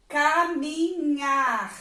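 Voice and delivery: "Caminhar" very slowly as CA-MI-NHAR, final R sounded; syllable by syllable. Shown as a demonstration. Teacher showing where the R is, syllable by syllable. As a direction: draw out each syllable of "Caminhar". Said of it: "onde é que está a letrinha R".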